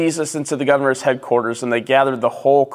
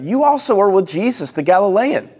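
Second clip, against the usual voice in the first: raised pitch, pointed accusation; single him out in a higher voice; accusing servant girl; 6 semitones higher than usual. A narrator's reading. Servant girl accuses Peter in reading.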